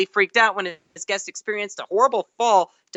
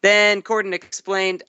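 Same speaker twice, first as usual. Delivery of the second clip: ordinary delivery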